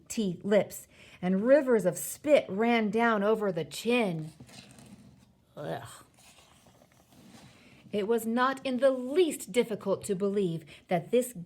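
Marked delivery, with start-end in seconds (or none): 5.6-5.8 short revolted "ugh"; brief noise of revulsion; disgusted groan. Real, not acted. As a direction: react with disgust. Reader disgusted by the description.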